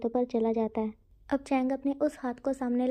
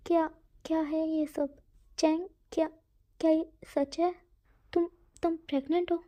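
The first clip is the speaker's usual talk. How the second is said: high, halting shocked questions; stunned disbelief; 4 semitones higher than usual. Acted. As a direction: stammer in sheer disbelief. Wing discovers Chang is pregnant. (हैरानी से, ऊँची अटकती आवाज़ में)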